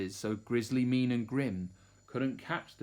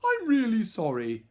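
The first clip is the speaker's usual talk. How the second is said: high, apologetic little voice; baby dinosaur; 8 semitones higher, 5 dB louder than usual. A narrator's reading. Third baby refusing his meat, high apologetic voice. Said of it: "And he said".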